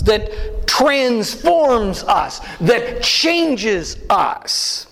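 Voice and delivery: very slow, each word drawn out; slow, deliberate emphasis. Acted. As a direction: stretch transforms and changes slowly. Preacher building a list.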